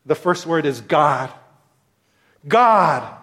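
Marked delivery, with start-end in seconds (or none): 2.5-3.1 God said loud and raised; stress on God. Shown as a demonstration. Preacher stressing the word God for his listeners. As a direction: hit the word God hard. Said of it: "allow me to emphasize each of these words".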